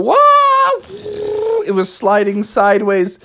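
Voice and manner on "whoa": high, drawn-out whoa; acted cry as the bus skids; long high-pitched "whoa"; alarmed whoa. Bus sliding off the road.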